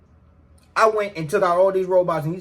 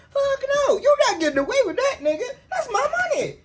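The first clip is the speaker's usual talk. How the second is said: much higher pitched, heated outburst; worked up; faster than usual. Real, not acted. To the host, fired up about getting his money, a high heated outburst. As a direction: get worked up and hit it high.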